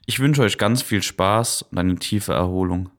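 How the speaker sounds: ordinary talk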